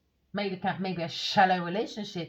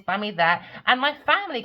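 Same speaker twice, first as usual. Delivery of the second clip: ordinary talk, nothing marked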